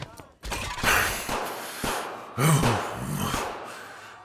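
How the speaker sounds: long groan of pain. Acted. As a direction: groan through the wound. Wounded after being shot.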